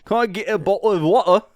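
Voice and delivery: "bottle" and "water" with the T swallowed, British accent; joking British accent ordering a drink; mock British accent. Shown as a demonstration. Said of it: "don't ever say the letter T".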